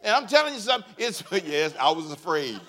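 ordinary delivery

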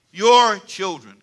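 put-on "Your children" drawn out slowly; slow and deliberate. Shown as a demonstration. Pastor coaching the congregation, slow deliberate "Your children". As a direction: stretch "Your" and "children" word by word. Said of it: "Can you say it slow?".

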